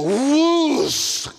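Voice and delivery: loud, high whoosh sound effect; sudden whoosh. Pretend. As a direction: burst out a rushing whoosh. Balaam falls.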